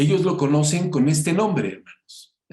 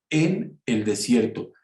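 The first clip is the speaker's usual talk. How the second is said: "en" set apart and raised, then "el desierto"; stress on EN. Shown as a demonstration. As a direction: lean on "en" in "en el desierto", slowly. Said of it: "Así completo, ¿eh? Como se los digo".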